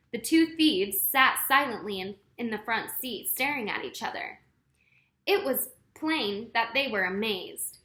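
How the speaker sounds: ordinary delivery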